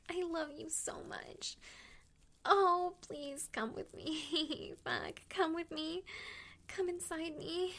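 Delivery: normal talking voice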